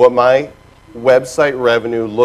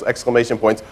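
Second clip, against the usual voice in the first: ordinary talk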